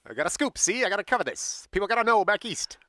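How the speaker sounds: a light newsreel-era Mid-Atlantic reporter; Mid-Atlantic accent. Retold or imitated. Eager writer chasing a scoop in a Mid-Atlantic accent. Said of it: "That is my Mid-Atlantic accent".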